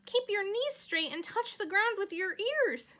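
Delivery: loud, high cry. Acted. Charlotte cries commands to the leaping pig, loud. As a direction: shout the command across the yard. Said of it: "called Charlotte".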